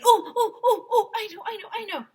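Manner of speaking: high, loud, excited oh oh I know; bursting to be called on; eager hand-raiser. A narrator's reading. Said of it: "waving her hand like she was cleaning".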